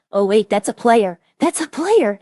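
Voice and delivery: loud, urgent imitation of a sudden realization; imitated alarm. Streamer retelling French spotting a player mid-shooting, loud mock alarm.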